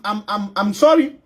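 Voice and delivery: ordinary delivery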